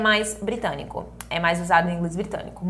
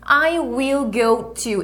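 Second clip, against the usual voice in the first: slow model phrase; 2 semitones higher, much slower than usual. Shown as a demonstration. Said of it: "Então, aqui".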